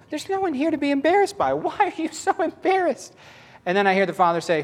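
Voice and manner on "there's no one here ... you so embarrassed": very high mock-exasperated questioning; high-pitched incredulity. Preacher replaying being questioned alone in camper, high incredulity. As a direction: question it in a squeaky, put-on high voice. Acting out scolding an embarrassed self.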